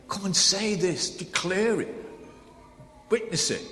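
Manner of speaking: a rousing proclamation; proclaiming shout. Shown as a demonstration. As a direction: call it out like a triumphant herald. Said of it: "the sound and shout of it".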